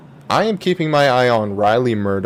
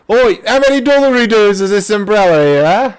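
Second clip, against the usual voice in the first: higher, hammed-up Aussie bloke haggling; mock Aussie accent; 7 semitones higher, 5 dB louder than usual. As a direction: ask the price like a cartoon Aussie. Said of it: "Aussie greatness".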